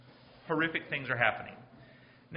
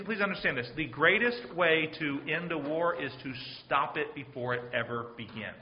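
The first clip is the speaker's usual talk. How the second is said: normal talking voice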